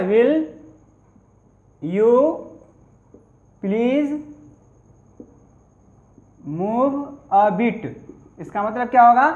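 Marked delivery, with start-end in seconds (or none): 0.1-7.9 "Will", "you", "please", "move a bit" each set apart by long pauses; word by word. Shown as a demonstration. Teacher dictating to students, slow word by word. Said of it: "कैसे कहिएगा?".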